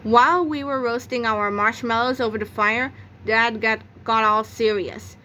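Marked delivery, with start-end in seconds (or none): none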